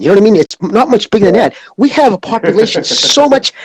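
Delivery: ordinary talk, nothing marked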